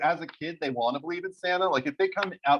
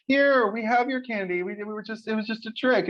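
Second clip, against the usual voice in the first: sheepish parents; 9 semitones higher than usual. Retold or imitated. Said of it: "they're just like".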